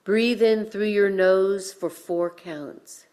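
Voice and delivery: ordinary delivery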